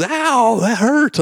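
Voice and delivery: pained yelp. Retold or imitated. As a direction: yelp like the foot was just kicked. She reacts to being kicked.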